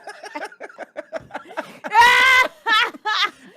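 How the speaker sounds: high loud burst of laughter; cracking up at himself; screaming laugh; shrieking laugh. Real, not acted. After his impression.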